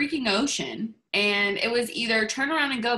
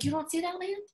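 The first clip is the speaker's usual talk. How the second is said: high, softer incredulous question; mock surprise; 9 semitones higher, 5 dB quieter than usual. Columbus asking David about the land, mock surprise. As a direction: ask it lightly, pitched well up.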